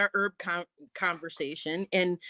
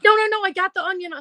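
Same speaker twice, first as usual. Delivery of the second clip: eager protest; 9 semitones higher, 4 dB louder, faster than usual. Retold or imitated. Stopping the lady, excited.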